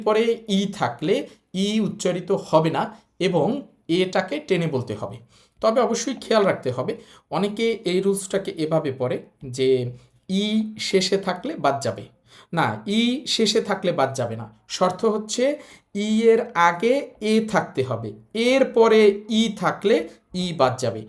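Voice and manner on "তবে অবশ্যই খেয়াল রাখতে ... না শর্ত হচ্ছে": ordinary talk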